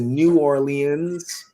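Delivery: "New Orleans" slowed, every syllable, Or-lee-ans; mock her careful "New Orleans", slow; imitating full enunciation. Friend mimics her careful New Orleans, slow and enunciated. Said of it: "she pronounced every letter in New Orleans".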